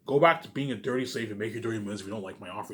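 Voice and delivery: ordinary delivery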